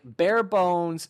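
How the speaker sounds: usual speaking voice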